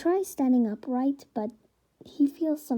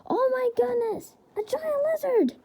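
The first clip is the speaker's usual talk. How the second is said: high, shrill-free panicked yelp, much higher; startled yell; 13 semitones higher than usual. Acted. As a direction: cry out in shock at the lizard. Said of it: "yells out".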